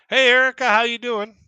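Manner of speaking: loud, high-pitched hello; calling out greeting. Real, not acted. Streamer greeting a listener who joined, calling out.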